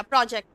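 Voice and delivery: प्रोजेक्ट said with the final t barely touched; soft final t. Shown as a demonstration. Teacher shows students how to end प्रोजेक्ट. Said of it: "मैंने टी को sound नहीं किया".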